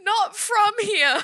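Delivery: high, slow words squeezed through laughter; can barely get it out for laughing. Real, not acted. Telling friend she scared a stranger, laughing hard.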